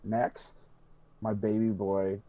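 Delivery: ordinary delivery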